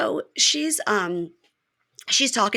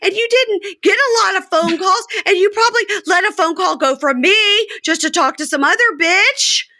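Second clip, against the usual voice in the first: much higher, louder mock rant; voicing Joy ranting; 13 semitones higher, 4 dB louder than usual. Podcaster as Joy ranting at Red, high and loud. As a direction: fire off jealous accusations.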